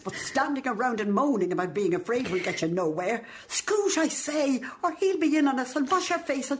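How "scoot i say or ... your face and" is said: sharp, higher urging; shooing. Acted. The old woman shoos the girl to fetch her bag, higher. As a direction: chivvy her out the door.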